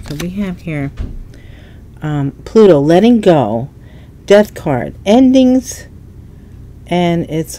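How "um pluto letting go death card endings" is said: ordinary talk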